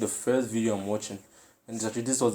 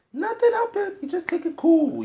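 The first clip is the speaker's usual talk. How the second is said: mock cool; 8 dB louder than usual. Mocking brave pupil's act.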